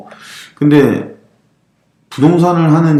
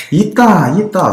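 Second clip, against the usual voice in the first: higher-pitched imitation of Korean dialect; Korean regional dialect; 7 semitones higher, slower than usual. Teasing coworker about the café bill, Korean dialect. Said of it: "사투리로".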